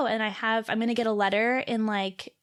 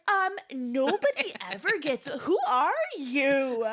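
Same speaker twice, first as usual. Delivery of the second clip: higher, drawn-out amazed delight; acted amazement; 4 semitones higher than usual. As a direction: gush in disbelief at a stranger, drawing it out. Acting out amazed delight at nurse.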